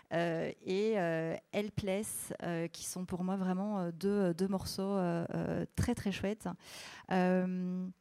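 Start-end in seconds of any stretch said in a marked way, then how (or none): none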